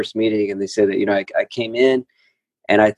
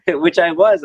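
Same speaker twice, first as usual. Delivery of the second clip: laughing; 8 semitones higher than usual. Real, not acted. Laughing at his own insanity.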